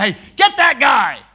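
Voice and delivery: loud, angry order; commanding shout. Retold or imitated. Jeroboam ordering his men to seize the prophet, shouting. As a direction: point and bark the order.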